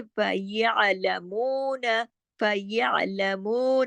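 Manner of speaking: फयअलमून with a long held मू; sustained light-lettered lesson recitation; lengthened madd. Shown as a demonstration. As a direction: recite फयअलमून slowly, stretching the oo. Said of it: "इसको दो हरकत खींचना है".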